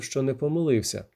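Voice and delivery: plain reading voice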